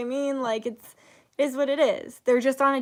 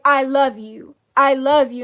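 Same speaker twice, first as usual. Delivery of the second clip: loud, earnest repetition; loud affirmation; 2 semitones higher, 10 dB louder than usual. Retold or imitated. Strangers facing each other in the studio, loud earnest. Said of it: "literally be like".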